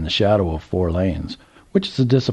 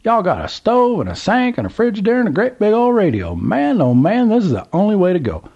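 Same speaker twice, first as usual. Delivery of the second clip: put-on old Southern gentleman; 12 semitones higher, 3 dB louder than usual. A narrator's reading. Old man calls to trucker, high Southern voice. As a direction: play the curious old man at the gas pump.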